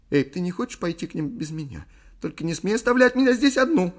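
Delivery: high plea climbing to a loud wail; panicky pleading. A narrator's reading. Scared woman forbids leaving her. (паническая мольба, переходящая в вопль)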